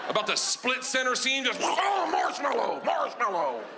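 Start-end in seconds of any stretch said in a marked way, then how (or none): 1.6-3.6 high, slow, drawn-out wail; hammed-up cry of distress; wailed safe word. Man pinned by a bear calling for rescue, wailing.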